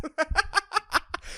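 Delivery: high, light cackle in a run of bursts; helpless high giggling; high-pitched laugh. Real, not acted. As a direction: laugh high at your own misery. Laughs at his own bad decisions.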